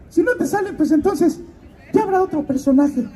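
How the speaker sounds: high put-on voice of a godmother. Godmother promising to call the actor, in a high put-on voice. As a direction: promise it in a high-pitched caricature.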